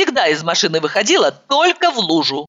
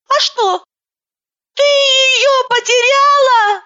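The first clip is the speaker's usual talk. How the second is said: high-pitched woman on the phone; 14 semitones higher, 3 dB louder than usual. Acted. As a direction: play her as a theatrical, fluttery woman. Vlasova's voice on the phone.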